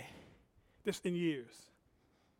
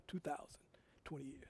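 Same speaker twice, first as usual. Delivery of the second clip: quiet aside; 4 semitones lower, 17 dB quieter than usual. Shown as a demonstration. Quiet first saying of date.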